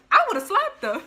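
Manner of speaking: high, loud hype; guy on the train, loud and high; excited. Retold or imitated. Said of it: "He was like".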